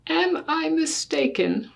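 ordinary delivery

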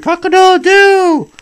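high, loud cock-a-doodle-doo crow; rooster crow. Acted. Father reading a bunny story to his child, crowing like a rooster.

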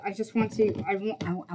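ordinary delivery

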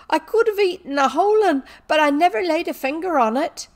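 high, loud wailing protest; acted tearful servant girl; weeping. A narrator's reading. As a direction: cry out your innocence. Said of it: "wept Becky".

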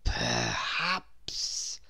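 slow, soft, wheezy and oily; crocodile's oily slow wheeze. A narrator's reading. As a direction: ooze the offer out slyly. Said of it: "wheezed a particularly oily voice".

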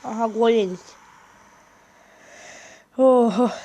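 normal talking voice

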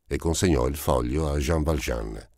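usual speaking voice

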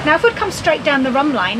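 ordinary talk, nothing marked

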